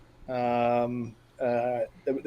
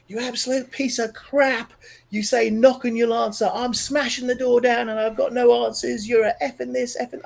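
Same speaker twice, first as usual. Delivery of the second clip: furious cry thrown at the sky, pitched much higher; yelling at God; 8 semitones higher than usual. Retold or imitated. Angry man in his room screaming at God in retold fury. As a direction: rage at a silent heaven, voice cracking high. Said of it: "I was just yelling at God".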